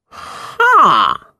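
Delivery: drawn-out mysterious fortune-teller. A narrator's reading. Fortune-teller reads palm.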